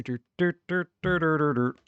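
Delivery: normal talking voice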